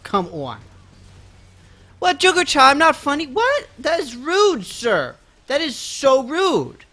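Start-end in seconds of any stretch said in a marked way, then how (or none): 2.0-6.8 high, playful indignation; hammed-up offended streamer; mock offended. Real, not acted. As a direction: act insulted by the joke. Chat calls him unfunny.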